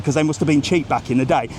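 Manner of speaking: ordinary talk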